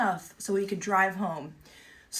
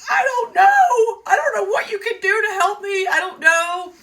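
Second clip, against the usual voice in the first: high, loud, tearful wail, near sobbing; 11 semitones higher, 9 dB louder than usual. Retold or imitated. Owner to a helpful stranger, wailing tearfully. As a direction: cry it out helplessly, voice up high and loud. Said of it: "starting to cry".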